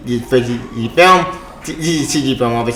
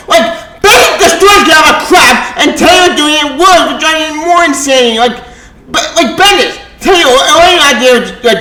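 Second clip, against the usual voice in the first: high-pitched angry rant; 9 semitones higher than usual. Real, not acted. Ranting about the writers.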